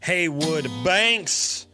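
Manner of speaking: normal talking voice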